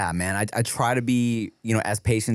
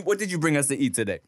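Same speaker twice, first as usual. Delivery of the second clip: usual speaking voice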